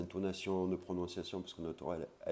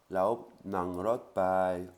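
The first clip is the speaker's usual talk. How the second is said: "Lao nang rot pai" said slowly and louder; slow loud demo; 7 dB louder, much slower than usual. Shown as a demonstration. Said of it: "On y va en voiture".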